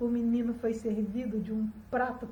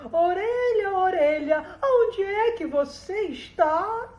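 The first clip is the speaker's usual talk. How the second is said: raised, drawn-out summoning call; loud singsong call; calling out to the ear; 5 semitones higher, 5 dB louder, slower than usual. A narrator's reading. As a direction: call for something hidden, stretching the question. Stepmother calls out to the ear.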